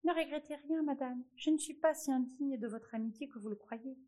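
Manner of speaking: high, small mouse voice; little mouse voice. A narrator's reading. Mouse reassures the queen in a high little voice. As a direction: squeak it like a tiny mouse pleading. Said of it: "La souris commença à dire".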